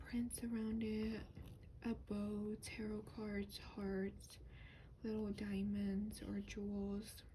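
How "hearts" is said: ordinary talk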